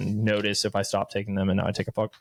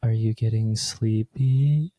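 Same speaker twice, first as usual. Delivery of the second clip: slow, drawn-out, slightly louder sleepy try; 4 dB louder, much slower than usual. Shown as a demonstration. Host testing a sleepy podcast voice, slow and drawn out. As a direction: stretch it out like a half-hearted sleepy voice. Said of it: "What is a sleepy voice?".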